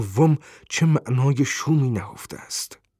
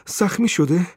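anxious question; 7 semitones higher, 4 dB louder than usual. A narrator's reading. Mother interrupts in fear.

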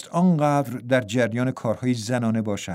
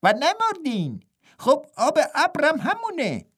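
exclaiming twin; 12 semitones higher than usual. A narrator's reading. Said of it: "این یانگ فریاد زد".

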